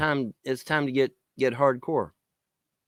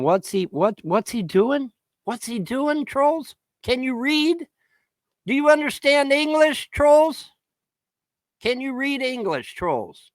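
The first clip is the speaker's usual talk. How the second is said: high-pitched, needling questions; mocking taunt; 12 semitones higher, slower than usual. Real, not acted. Ranter quizzing online trolls, mocking taunt. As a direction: quiz them as if they were slow, pitched way up.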